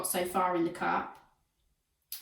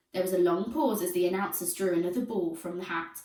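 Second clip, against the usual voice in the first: ordinary delivery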